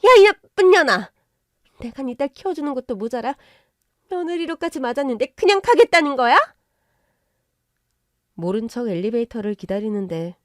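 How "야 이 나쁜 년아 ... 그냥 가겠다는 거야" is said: high-pitched screamed abuse at someone leaving; explosive loud tirade; furious yelling. Acted. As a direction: yell after them, outraged. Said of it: "소리소리 지르더라고요".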